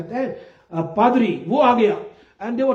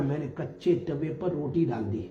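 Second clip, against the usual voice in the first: softer and lower, lamenting; mournful regret; 7 semitones lower, 8 dB quieter than usual. Retold or imitated. Elder lamenting his haste, soft and sorrowful. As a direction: say it like a weeping confession.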